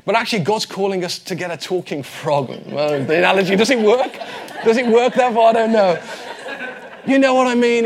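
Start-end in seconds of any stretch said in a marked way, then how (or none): 2.6-7.9 laughing. Real, not acted. Doubting own analogy, laughing.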